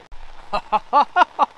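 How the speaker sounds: delighted high burst of laughter; excited laugh. Real, not acted. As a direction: laugh with real surprise. Finds amethyst inside.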